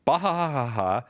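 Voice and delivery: hammed-up "bah ha ha" from a tweet; mock laugh. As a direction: spell out a fake laugh, falling away. Reads out a tweet's written laugh.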